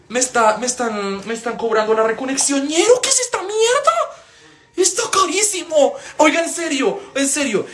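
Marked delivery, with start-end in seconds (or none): 2.7-3.9 high, wailing cry of disbelief; half-laughing real outrage at the price; shocked outcry. Real, not acted. Shocked by the electricity bill. 4.8-7.7 disbelief. Real, not acted. Still reeling at the bill.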